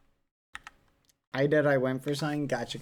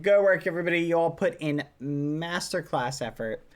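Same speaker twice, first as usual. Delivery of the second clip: ordinary delivery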